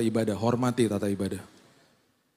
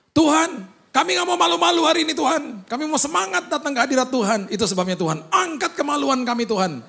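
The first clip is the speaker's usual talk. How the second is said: much higher, loud, fervent prayer voice; fervent worship leader; 13 semitones higher, 4 dB louder than usual. Retold or imitated. Worship leader opening the service in prayer, fervent and loud. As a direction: pray with over-the-top zeal.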